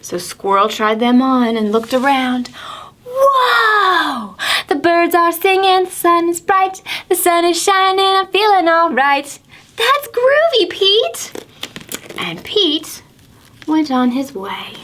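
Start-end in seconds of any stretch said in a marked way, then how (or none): 3.1-9.3 high shouted whoa, then a happy lilt; excited squirrel. Acted. Squirrel seeing the world through magic sunglasses, high and thrilled. 9.8-11.2 very high, cool squirrel voice; groovy squirrel. Acted. Squirrel thanking Pete, very high and cool.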